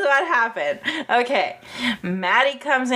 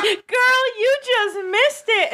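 excited call out; 13 semitones higher than usual. Acted. Telling the arriving guest she missed the fight, high and excited. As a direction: squeal it out like spilling gossip.

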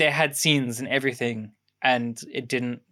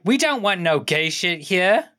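raised, louder mocking refusal; mock rejecting campus; 5 semitones higher, 4 dB louder than usual. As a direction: imitate a campus turning them away, pitched up. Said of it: "Basically being like".

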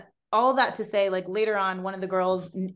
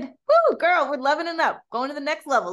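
high, loud hype; celebratory cheer; 7 semitones higher, 5 dB louder than usual. Acted. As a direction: whoop and hype up a girlfriend. Cheering a passed life test.

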